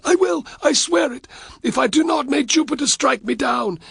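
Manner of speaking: high, loud frantic promise; groveling Varus. A narrator's reading. Varus swears in terror.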